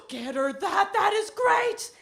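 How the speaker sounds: big gasp, then building high and loud; excited husband. Retold or imitated. Husband cheering on his mother's gift shopping by phone, excited. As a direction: gasp and rise into loud delighted approval. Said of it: "he's getting excited".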